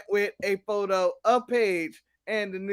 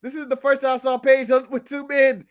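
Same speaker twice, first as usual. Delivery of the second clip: excited joke setup; 5 semitones higher, 7 dB louder than usual. Real, not acted.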